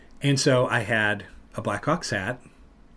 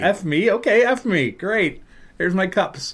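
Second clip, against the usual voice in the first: mock taunt; 7 semitones higher, 4 dB louder than usual. Mocking reply to rival fans.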